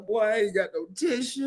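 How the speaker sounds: ordinary talk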